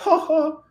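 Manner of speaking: high-pitched fake "ha ha"; mocking two-beat laugh. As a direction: imitate a put-on society laugh, pitched well up. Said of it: "he went to laugh".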